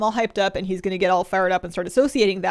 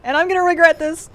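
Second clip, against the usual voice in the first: giddy; 10 semitones higher, 7 dB louder than usual. Real, not acted. Laughing, gushing about puppy.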